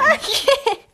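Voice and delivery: giggling through the words, can't stop; words squeezed out mid-laugh. Real, not acted. Co-host told to stop laughing, still cracking up. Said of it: "Stop laughing".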